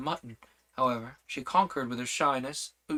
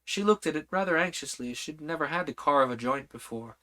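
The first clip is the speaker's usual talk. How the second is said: plain reading voice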